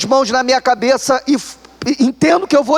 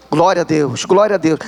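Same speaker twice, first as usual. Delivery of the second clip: low murmured praise; 7 semitones lower than usual. Retold or imitated. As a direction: lower evasive glória. Still dodging by praising.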